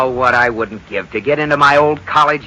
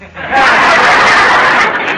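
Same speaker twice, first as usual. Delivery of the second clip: high loud breathy laugh; high, loud laughing burst; 9 semitones higher, 5 dB louder than usual. Real, not acted. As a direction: laugh off the insult. Laughs at Liz's jab.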